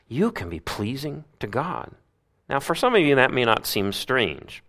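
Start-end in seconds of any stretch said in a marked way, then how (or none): 0.1-1.9 said again much softer, stress on you; soft repeat. Shown as a demonstration. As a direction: let it sink in quietly.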